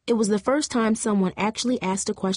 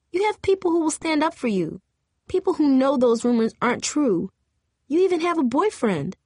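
put-on raised, higher voice of a hurt girl; upset teenage girl; 7 semitones higher than usual. A narrator's reading. Angel telling Jamie she has friends, upset and higher. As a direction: let her hurt spill over as she argues.

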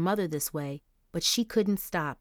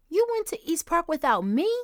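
higher, whiny complaint; moaning little brother; 10 semitones higher, 4 dB louder than usual.

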